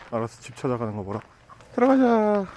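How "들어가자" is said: high sing-song coaxing; coaxing a dog. Real, not acted. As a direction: coax the puppy inside.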